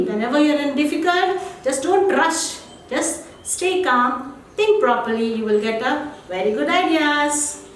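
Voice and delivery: ordinary talk, nothing marked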